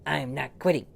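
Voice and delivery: loud stubborn refusal. Acted. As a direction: shout it back at the dog. Repeated refusal, loudest.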